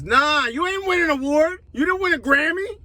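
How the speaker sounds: ordinary delivery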